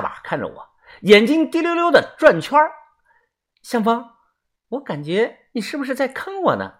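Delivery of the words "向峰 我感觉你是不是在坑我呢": high, soft voice, doubtful; suspicious Yezi. Acted. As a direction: probe gently with suspicion in a small high voice. (细声细气地怀疑试探)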